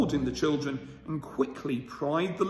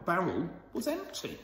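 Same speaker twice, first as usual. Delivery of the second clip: plain reading voice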